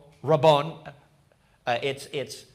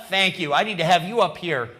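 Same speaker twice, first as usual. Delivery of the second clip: ordinary delivery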